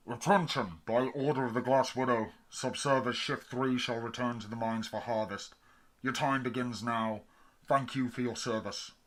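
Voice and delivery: loud, high, mechanical announcer over a PA; cold automated loudspeaker voice; automated announcement. A narrator's reading. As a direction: boom it out like a tannoy. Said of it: "The automated announcement chimed".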